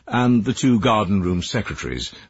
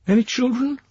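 lightly voiced woman. Joan's voice.

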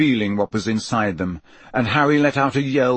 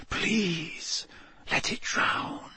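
hushed, desperate inner plea; pleading whisper; 7 semitones higher, 9 dB quieter, much slower than usual. A narrator's reading. Harry pleading in thought as his scar burns, soft whisper. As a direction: beg under the breath, very softly.

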